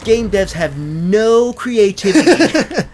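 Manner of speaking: slow deadpan complaint; mock serious. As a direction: fake outrage, drawn out. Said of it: "say that with a straight face".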